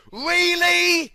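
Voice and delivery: high, startled Really; shocked. Acted. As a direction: gasp it out in disbelief. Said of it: "looked shocked with his mouth open".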